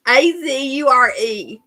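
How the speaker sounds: usual speaking voice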